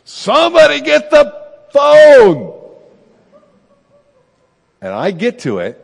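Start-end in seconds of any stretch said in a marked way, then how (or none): 0.0-2.6 full-volume high holler, last word stretched; exasperated shout, slow and loud; yelling through the house. Retold or imitated. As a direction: bellow it to everyone in the house. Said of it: "I just yell out in the whole house".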